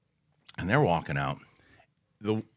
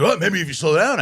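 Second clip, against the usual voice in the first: higher, louder mock retort; irritated retort; 6 semitones higher, 5 dB louder than usual. Husband to the driver, imitated irritated retort. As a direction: imitate an annoyed man snapping back. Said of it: "And he's like".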